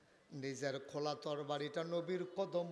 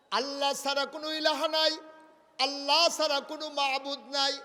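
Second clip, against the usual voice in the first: raised, proclaiming sermon voice; fervent preaching; 11 semitones higher, 13 dB louder than usual. Acted. Preacher proclaiming Umar's conversion, fervent preaching. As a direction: ring out the declaration of faith.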